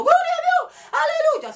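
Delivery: high-pitched imitation of excited worshippers crying out; mock congregation shouting. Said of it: "Porque ven gente gritando".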